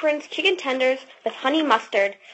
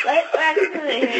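laughing; much slower than usual. Real, not acted. Daughter cracking up mid-take.

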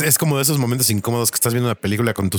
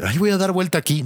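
mock ship captain; 8 semitones higher than usual. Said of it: "No hay modo de que".

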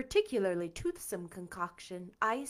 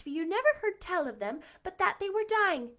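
ordinary delivery

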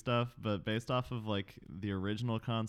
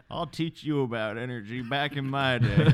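put-on grumpy old-timer, higher and louder; old prospector; 5 semitones higher, 9 dB louder than usual. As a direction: scold like a cranky old coot. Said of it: "my character is gonna be a two hundred fifty-year-old draft dodger".

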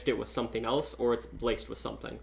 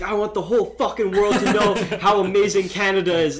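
loud, higher-pitched mock rant; freaking-out patriot; 7 semitones higher, 7 dB louder than usual. Friend freaking out by the campfire about Canada, mock-frantic shouting. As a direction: imitate a worked-up patriot getting louder. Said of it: "just starts freaking out".

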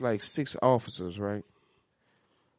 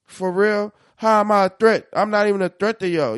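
retold indignant protest; 5 semitones higher, 8 dB louder than usual. Retold protest to cuffing officers.

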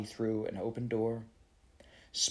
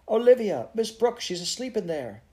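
high, loud cry of alarm; alarmed shout; 11 semitones higher, 6 dB louder than usual. Acted. Kizzy realising someone's asleep in the fire, alarmed shout. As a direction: shout names out, urgent and high.

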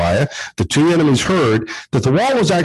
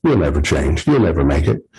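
a low, scornful voice from the past, lowered pitch; lower haunting voice; 8 semitones lower than usual. Retold or imitated. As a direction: say it like the old voice that still haunts him. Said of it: "a voice from your past".

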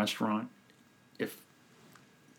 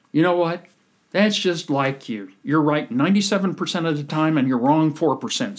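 louder and higher, fed-up retort; angry outburst; 6 semitones higher, 7 dB louder than usual. Retold or imitated. Friend snaps at the Buick owner before storming out, loud and higher. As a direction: blow up at your friend, louder, slowing on that's just like you. Friend angrily ends the quarrel.

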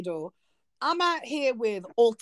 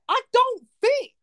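exasperated outcry; 12 semitones higher than usual. Real, not acted. Frustrated outburst at labellers.

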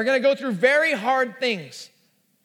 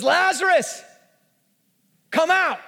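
raised, higher calling voice; loud voice; 5 semitones higher, 3 dB louder than usual. Acted. Jesus summons Lazarus from the grave, in a raised loud voice. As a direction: call out a command into a tomb. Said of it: "in a loud voice".

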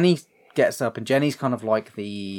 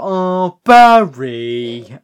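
weary sigh of a name, much louder; exasperated girlfriend; 3 semitones higher, 10 dB louder, much slower than usual. Retold or imitated. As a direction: drag out Barry like she's seen this before. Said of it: "she's like".

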